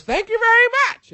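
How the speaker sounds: very high, squealed falsetto thanks; Jiminy Glick. Retold or imitated. Comedian answering a fan in his character's voice, falsetto impression. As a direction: swoop way up into a silly character squeal. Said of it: "does the fucking voice back to me".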